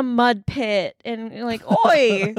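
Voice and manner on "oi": attempted Aussie call; bad Australian accent. Mud-pit hero hailing a woman, bad Australian accent. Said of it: "I'm notoriously bad at accents".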